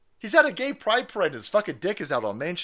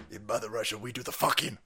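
put-on low, softer Russian-accented boast; mock Russian accent; 5 semitones lower, 5 dB quieter than usual. Host imitating a Russian boast, mock Russian accent. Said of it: "one of those Russian things in their voice".

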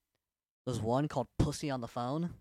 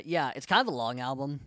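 usual speaking voice

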